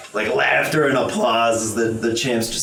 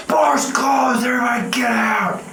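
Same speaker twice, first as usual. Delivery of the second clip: shouted call; 13 semitones higher than usual. Acted. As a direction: bellow it across the room. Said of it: "you just hear".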